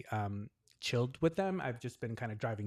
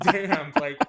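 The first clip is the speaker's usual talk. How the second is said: loud, higher, laughing exclamation; retold amazed reaction; 3 semitones higher, 11 dB louder than usual. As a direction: blurt it out loudly with a laugh. Retold shocked reaction to age remark.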